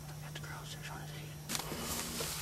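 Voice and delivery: slow tense whisper; wildlife watching whisper. Real, not acted. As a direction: whisper while aiming. Hunters whispering in blind.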